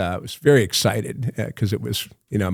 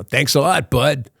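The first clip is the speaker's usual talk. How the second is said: put-on higher, lightly done Brooklyn cabbie accent; tossing off thanks in a Brooklyn accent; 4 semitones higher than usual. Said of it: "that same Brooklyn accent".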